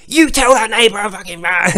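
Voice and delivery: loud, hammed-up furious husband; mock angry husband. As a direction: snarl it like a jealous wife-beater. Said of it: "And then he goes".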